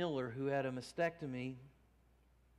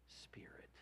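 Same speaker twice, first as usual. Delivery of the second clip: whisper; 17 dB quieter than usual. Acted. End of scripture reading.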